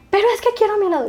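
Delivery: put-on high pleading little boy's whine; whiny child. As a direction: whine like a kid who wants his cone. Child whining for his ice cream.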